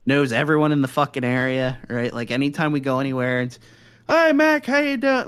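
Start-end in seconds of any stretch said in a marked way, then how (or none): 4.1-5.3 high, loud hail to an acquaintance; imitated gushing hello, pitched way up; mock friendly greeting. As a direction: call it out like a delighted local. Imitating locals greeting his grandfather.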